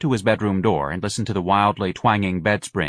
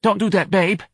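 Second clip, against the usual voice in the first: high-pitched, hasty mother; quick protest; 11 semitones higher, 3 dB louder than usual. A narrator's reading. Mother heading off her friend's visit, quick and high. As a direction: cut the idea off in a raised voice. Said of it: "Missus Riley said quickly".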